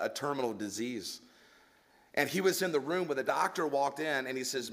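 normal talking voice

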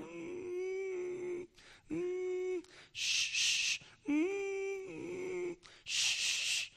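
high whimpers and shushes; a small girl fighting tears; whimpering child. Retold or imitated. Frightened little girl whimpering in turbulence, high and soft. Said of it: "the little girl's going".

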